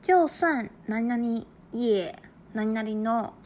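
就算何々也何々 spelled out slowly; slow pattern reading. Shown as a demonstration. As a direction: read the pattern slowly and clearly. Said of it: "例をもう一つ". (文型をゆっくり)

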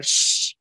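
sharp librarian shush; shushing hiss. Retold or imitated. Librarian shushing a chatty child, a sharp shush. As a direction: hush them like a librarian.

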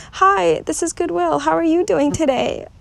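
forced cheerful phone greeting; fake cheery greeting. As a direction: put on a chirpy front for work. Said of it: "supposed to go to work and be like".

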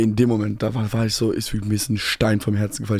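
normal talking voice

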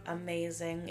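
ordinary talk, nothing marked

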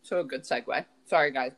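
ordinary talk

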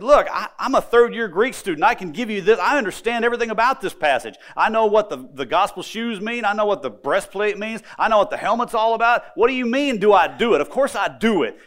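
a proud student brushing off a silly question; indignant student. Retold or imitated. Larry insists to his professor he already knows, indignant.

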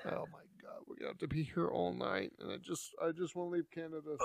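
hushed, near-whispered despair; whispered misery at being stuck. Acted. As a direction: sink into a soft hopeless moan.

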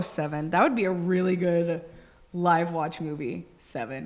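ordinary talk, nothing marked

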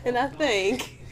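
stumbling, giggling through the lines; can't stop laughing; laughing while reading. Real, not acted. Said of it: "you just stutter on every word".

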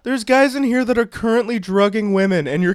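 high-pitched incredulous protest; hammed-up righteous complaint; mock indignation. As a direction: plead the case to a bouncer, outraged. Playing friend's outraged protest.

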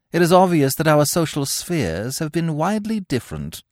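haughty snob. Acted. Gwendolen snubbing Cecily's social sphere. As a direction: look down your nose.

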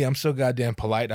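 usual speaking voice

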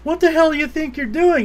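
high, loud mock outrage; indignant guard. Guard challenges intruder, retold.

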